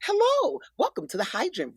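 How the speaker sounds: bright, polished announcer welcome, lightly done; a hint of a slick corporate greeter; mock corporate voiceover voice. Shown as a demonstration. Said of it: "it had to be this voice".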